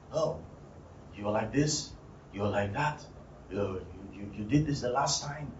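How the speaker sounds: low, soft, muttered accusations; retold accusing murmur. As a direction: needle them quietly under your breath.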